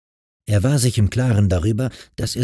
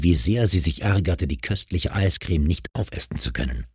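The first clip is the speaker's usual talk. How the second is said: usual speaking voice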